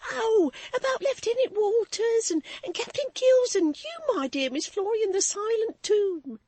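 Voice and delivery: high, loud, emotional servant; breathless and overwrought; hysterical maid. A narrator's reading. Susan voiced, hysterical.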